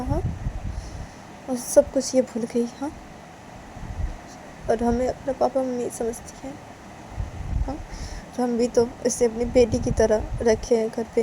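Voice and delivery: high-pitched pondering with questioning pauses; mother thinking aloud. Acted.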